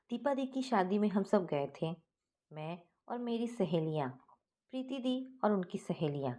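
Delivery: plain reading voice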